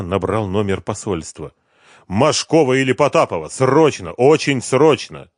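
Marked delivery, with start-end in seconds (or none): 2.1-5.3 loud, raised, urgent call; pressing and emphatic; urgent demand. A narrator's reading. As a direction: demand someone on the phone, urgently.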